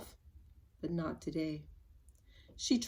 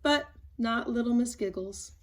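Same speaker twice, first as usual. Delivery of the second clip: plain reading voice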